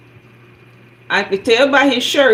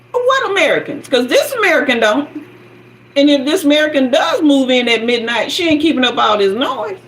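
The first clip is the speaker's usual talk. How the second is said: scolding; 6 semitones higher than usual. Retold or imitated. Scolding the noisy neighbor.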